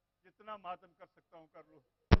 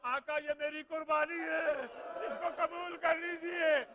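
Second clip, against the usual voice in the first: higher, louder, slowed wailing widow; a mother's loud lament from inside the tent; widow's cry; 10 semitones higher, 11 dB louder, slower than usual. Acted. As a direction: cry out as a grieving widow offering her son.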